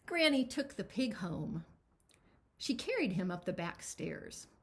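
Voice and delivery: plain reading voice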